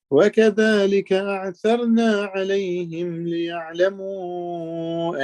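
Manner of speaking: melodic chanted recitation of the verse; Quran recitation. Acted. Teacher reciting the verse to his listeners, melodic chant. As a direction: intone the verse in tajweed style.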